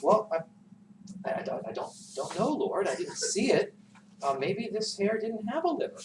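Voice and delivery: nervous stammer. Acted. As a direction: fumble the words like a caught liar. Peter stammering his lie.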